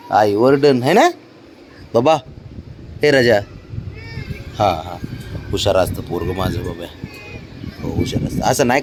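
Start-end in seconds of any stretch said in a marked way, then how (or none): 4.6-8.4 soft, low doting voice; tender praise. Real, not acted. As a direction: dote on him softly. Father praising child tenderly.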